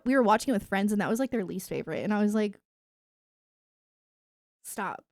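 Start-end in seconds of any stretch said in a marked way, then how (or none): none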